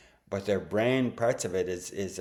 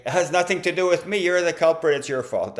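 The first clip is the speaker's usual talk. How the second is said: loud, high, rapid-fire mock accusation; hammed-up finger-pointing outburst, pitched high and loud; accusing blame; 9 semitones higher, 9 dB louder, faster than usual. Partner blames the other for everything, mock accusing.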